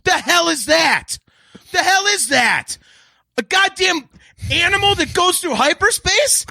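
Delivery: slow, high, yelled disgust; outraged shouting. Real, not acted. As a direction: bellow it in genuine fury. Raging about space whales.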